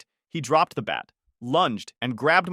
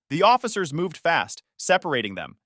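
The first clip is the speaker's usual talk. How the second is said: usual speaking voice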